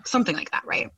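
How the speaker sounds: ordinary talk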